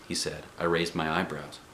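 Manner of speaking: usual speaking voice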